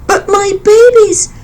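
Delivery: high, pleading bird voice; crying mother bird. A narrator's reading. Grieving mother bird to the elephant, high and pleading. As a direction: plead over your dead babies. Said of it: "cried the mother bird".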